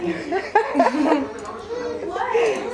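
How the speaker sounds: giddy laugh. Real, not acted. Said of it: "that pill just got to me".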